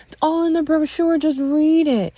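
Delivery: high, loud defensive retort; loud, urgent insistence; exasperated shout. Acted. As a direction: shout back in frustration. Shouted back in quarrel.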